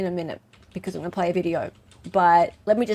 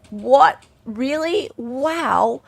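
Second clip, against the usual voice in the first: higher-pitched mock astonishment, louder on the first word; surprised reaction; 5 semitones higher, 5 dB louder, much slower than usual. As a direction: retell being amazed. Retelling own surprised reaction.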